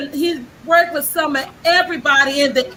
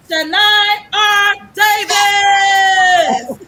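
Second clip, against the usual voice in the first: slow, raised build to a shouted surname; announcer's big reveal; 5 semitones higher, 4 dB louder, much slower than usual. Real, not acted. Host announces the guest's name to the show, announcer's big reveal. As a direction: draw out the name, higher, ending in a shout.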